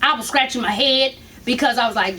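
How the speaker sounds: normal talking voice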